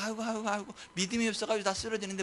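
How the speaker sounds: soft, weary lament; hushed, helpless groaning words; mock feeble moaning. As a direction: imitate a flock losing heart. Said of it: "성도들이 다".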